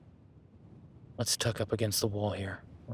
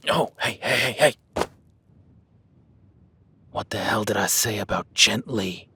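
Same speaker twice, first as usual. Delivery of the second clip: sharp angry rebuke; 10 dB louder than usual. Acted. Hunter snapping at his clumsy partner in the cave. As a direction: snap at him for making noise.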